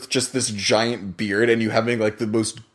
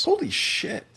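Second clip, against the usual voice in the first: high, shocked outburst; shocked exclamation; 4 dB quieter, slower than usual. Real, not acted. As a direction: blurt it out in disbelief. Shocked reaction.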